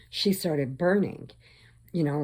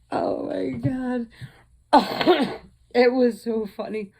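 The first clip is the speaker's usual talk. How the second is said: laughing; 7 semitones higher, 5 dB louder than usual. Real, not acted. Recovering from laughter.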